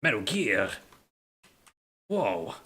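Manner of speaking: low Snake-style voice impression; David Hayter. Streamer showing off his best impression for chat, imitated action-hero voice. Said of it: "David Hayter impression you're gonna get from me".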